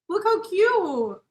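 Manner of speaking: slow, high, loud cooing; gushing. Real, not acted. Showing the book's drawings to viewers, high slow cooing. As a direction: coo over a tiny kitten.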